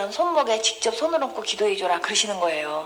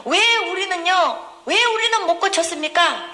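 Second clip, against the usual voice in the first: high, pleading acted question; plaintive questioning; 7 semitones higher, 4 dB louder than usual. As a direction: cry out as puzzled followers demanding a reason. Acts out disciples' baffled question.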